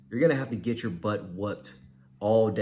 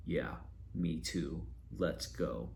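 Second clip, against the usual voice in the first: deadpan; 5 semitones lower, 10 dB quieter, slower than usual. Shown as a demonstration. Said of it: "that doesn't work".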